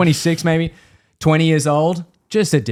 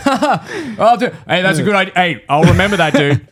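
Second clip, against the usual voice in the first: higher, louder, laughing fake enthusiasm; mock eager reply; 6 semitones higher, 3 dB louder than usual. To the Italian guy, laughing fake-eager agreement. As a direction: humour him brightly.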